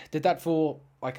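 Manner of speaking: plain reading voice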